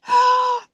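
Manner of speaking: a high, loud mock gasp of alarm; sharp gasp. Imitating her own panic at a rupture, a sudden loud gasp. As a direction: gasp as if caught off guard.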